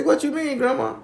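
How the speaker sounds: high, loud puzzled demand; startled grandson. Retold or imitated. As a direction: reenact being taken aback by grandma. Narrator reacts to grandma's remark.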